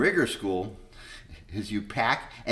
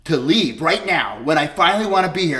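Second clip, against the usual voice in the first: furious, loud and high; yelling at the insurance reviewers; angry yelling; 6 semitones higher, 11 dB louder than usual. Retold or imitated. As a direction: shout it in outrage. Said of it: "And I started yelling".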